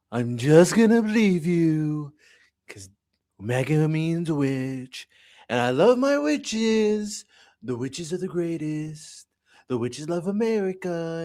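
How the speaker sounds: slow, drawn-out put-on Trump voice with big rises; mock Trump voice. Streamer imitates Trump's voice to chat, slowly. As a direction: ham up a slow, boastful politician's drawl. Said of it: "if I said it in this voice".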